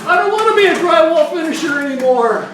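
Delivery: high-pitched turtle crying out for help; yelling cartoon turtle. Cartoon turtle begging Mister Wizard for rescue, high mock yell. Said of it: "So he'd start yelling".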